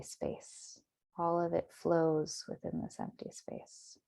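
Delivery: calm, measured meditation voice with long pauses; guided meditation. Acted. Meditation teacher settling listeners into their bodies, guided meditation. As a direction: guide them gently inward, unhurried.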